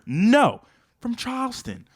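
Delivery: usual speaking voice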